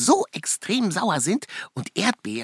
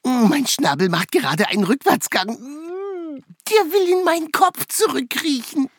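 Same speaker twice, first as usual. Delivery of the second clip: eating sour candy; 4 semitones higher than usual. Acted. Just bit a lemon.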